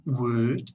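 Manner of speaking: would said as "wood"; careful pronunciation. Shown as a demonstration. Teacher models a word for learners, careful pronunciation of would as "wood". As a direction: say would with a silent L, drawn out alone. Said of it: "La pronunciación".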